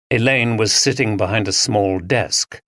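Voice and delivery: usual speaking voice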